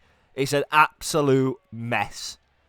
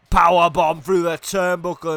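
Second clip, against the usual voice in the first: raised, punched commentary; excited move call; 5 semitones higher, 5 dB louder than usual. Real, not acted. Commentator calling a powerbomb, excited. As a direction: hit it as the move lands.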